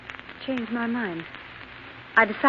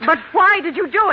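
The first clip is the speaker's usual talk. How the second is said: anguished cry; 7 semitones higher, 6 dB louder than usual. Acted. Wife pleading with husband.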